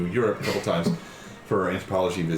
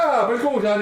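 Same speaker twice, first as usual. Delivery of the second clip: high, loud mock Italian; cartoon Italian accent; 14 semitones higher, 5 dB louder than usual. Sister getting Italians to talk to her, exaggerated cartoon accent. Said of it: "Like, like fucking Family Guy".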